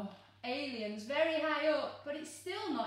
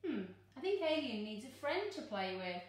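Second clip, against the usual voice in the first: usual speaking voice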